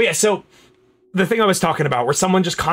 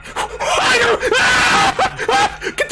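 very high panicked shrieks; startled screaming; much slower than usual. Real, not acted. Player jumped by game monsters, real high screams. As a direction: shriek in real fright, pitched way up.

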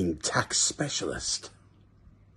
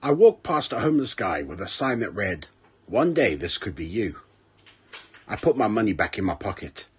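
ordinary delivery

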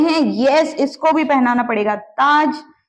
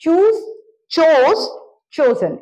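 emphatic correct forms; 4 semitones higher, slower than usual. Shown as a demonstration. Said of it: "teacher stressing correct forms".

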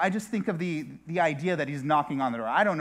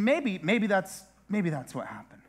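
ordinary talk, nothing marked

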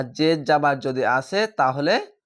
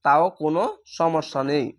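ordinary talk, nothing marked

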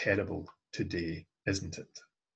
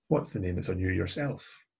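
usual speaking voice

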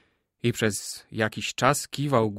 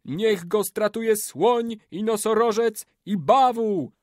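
high, slow incantation; curse leader; 10 semitones higher, 4 dB louder than usual. A narrator's reading. Old man cursing before the warriors, high chanting call. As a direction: chant the list of beasts high.

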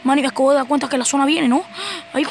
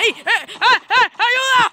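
panicked call for help; 6 semitones higher, 3 dB louder than usual. Real, not acted. Getting shot in game.

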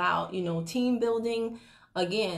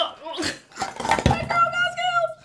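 shrieking laugh, much higher; loud high laugh; 6 dB louder than usual. Real, not acted. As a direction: burst out laughing. Laughing after rapping.

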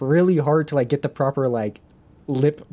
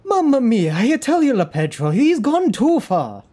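high, loud-mouthed stage Italian; hammy Italian accent; 8 semitones higher, 3 dB louder than usual. Acted. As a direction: wail it like a cartoon Italian. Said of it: "So Italian".

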